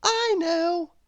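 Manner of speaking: high, bright-eyed know-it-all cry; eager little girl voice. A narrator's reading. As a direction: pitch it way up, keen to answer. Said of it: "said little Miss Brainy".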